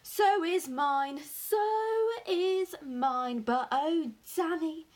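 loud, high, rising elation; overjoyed father exclaiming to his son; excited cry. A narrator's reading. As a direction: draw each word out in delight. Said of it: "he cried".

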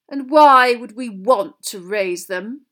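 slow, loud, disdainful question; cold. A narrator's reading. Malfoy challenging Hagrid's project, coldly scornful. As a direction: draw it out with icy scorn. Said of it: "said a cold voice".

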